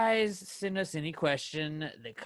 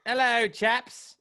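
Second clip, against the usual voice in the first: slow, high posh British greeting; slow mock-British hello; mock British accent; 10 semitones higher, 6 dB louder, much slower than usual. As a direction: ham up a fake British toff. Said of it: "he came back and he was like".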